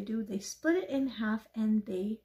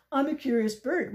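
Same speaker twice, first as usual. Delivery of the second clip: loud, higher scornful mimicry; mocking; 5 semitones higher, 7 dB louder than usual. Pigeon parroting the duck mid-rant, mocking. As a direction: throw the words back loudly, mocking. Pigeon mocks duck's words.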